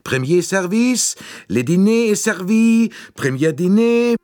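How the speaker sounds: high, sing-song dining car call; calling waiter. A narrator's reading. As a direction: call the diners to dinner like a French attendant. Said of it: "die auffordernd rief". (französischer Kellnerruf, hoch und gesungen)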